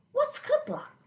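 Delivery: a baffled grandma asking; puzzled grandma. Acted.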